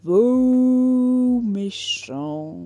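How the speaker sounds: slow, loud and drawn out; gushing villain praise. Acted. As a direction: savour each word, slow and loud.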